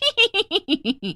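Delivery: high eerie ghostly cackle; evil cackle. Acted. Ghost woman laughing at the trapped family, evil cackle. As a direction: cackle in triumph.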